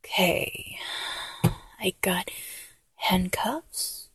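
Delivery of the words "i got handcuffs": whispered reveal of handcuffs; playful whisper. Acted. Showing the partner the handcuffs in a whisper. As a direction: hush it like a naughty secret.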